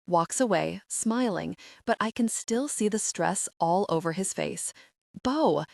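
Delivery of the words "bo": high, urgent shout of her name; roar. A narrator's reading. James on the phone roaring at Bo, high shout. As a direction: call her name in alarm. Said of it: "James roars".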